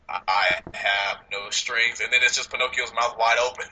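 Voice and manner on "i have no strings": slow, low imitation of a robot villain; Ultron. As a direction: draw it out low, word by word, like a trailer villain. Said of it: "the picture of Ultron at the end of the trailer saying".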